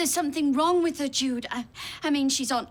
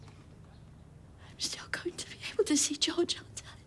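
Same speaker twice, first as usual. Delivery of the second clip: tearful whisper; 9 dB quieter than usual. Acted. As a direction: barely whisper the question, afraid of the answer. Mother pleading after being cut off.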